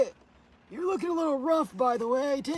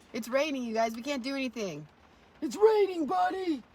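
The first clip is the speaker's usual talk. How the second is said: ordinary delivery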